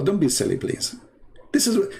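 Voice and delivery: normal talking voice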